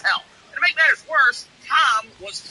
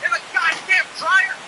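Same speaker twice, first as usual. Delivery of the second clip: angry neighbor; 10 semitones higher, slower than usual. Retold or imitated. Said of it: "He's like".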